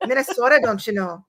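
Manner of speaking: put-on playful Minnesotan accent; Minnesota accent. Echoing her friend's joke, Minnesota accent. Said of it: "without saying Minnesota".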